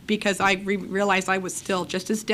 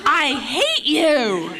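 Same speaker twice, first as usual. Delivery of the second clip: slow, drawn-out words through laughter; laughing mock outrage; 6 semitones higher, much slower than usual. Laughing at how he brushed her off, slow and drawn out. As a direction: stretch it out laughing in mock fury.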